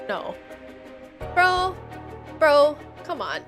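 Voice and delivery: usual speaking voice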